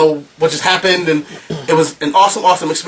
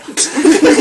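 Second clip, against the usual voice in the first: laugh; 12 semitones higher, 4 dB louder than usual. Real, not acted. Laughs at the crowd.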